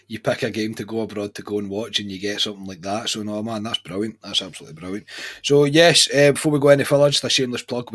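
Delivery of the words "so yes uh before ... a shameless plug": loud announcement. Real, not acted.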